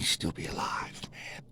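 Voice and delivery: whispered, soft sign-off; a hushed farewell whisper. Real, not acted. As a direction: whisper it goodbye, tenderly. Soft farewell to the late singer.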